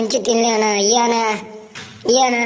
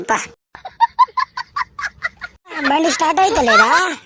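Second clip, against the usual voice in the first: ordinary talk, nothing marked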